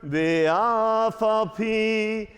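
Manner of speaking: normal talking voice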